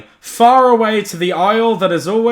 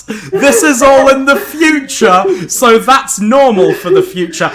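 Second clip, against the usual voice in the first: laughing; 5 semitones higher, 3 dB louder than usual. Real, not acted. Laughing while reading story.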